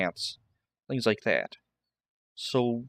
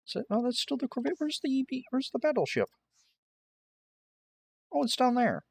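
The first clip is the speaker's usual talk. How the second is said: high surprised searching; 13 semitones higher, faster than usual. Real, not acted. Searching for the battleship.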